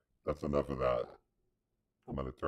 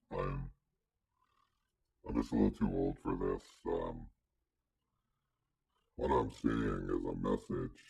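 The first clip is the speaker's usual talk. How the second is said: put-on much lower, creaky filtered old man; 10 semitones lower than usual. Shown as a demonstration. Streamer role-plays scam victim with old-man filter, much lower. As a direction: play a confused old man through the changer. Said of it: "Old man".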